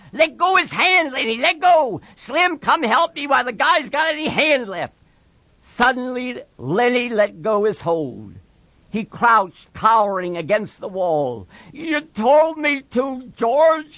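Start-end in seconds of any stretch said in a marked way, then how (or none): 0.1-4.9 high, urgent repeated command; pleading yell for help, pitched up; shouting. A narrator's reading. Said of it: "George shouted over and over". 11.7-14.0 high, slow wail; wretched, drawn-out whine, pitched way up; miserable. A narrator's reading. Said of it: "he said miserably".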